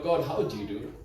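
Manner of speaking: dropped low and soft, an earnest aside; hushed repeat of the prayer; soft quoted prayer. Retold or imitated. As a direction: ask it gently, almost to yourself. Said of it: "asks God".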